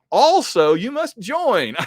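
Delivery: high, loud, slow mock menace; mock villain demand. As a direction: ham up the final demand like a cartoon villain. Punchline of the villains' note.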